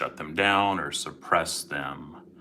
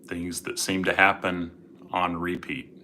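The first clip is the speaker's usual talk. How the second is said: guided meditation; 7 dB quieter than usual. Acted. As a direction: gently guide listeners into calm.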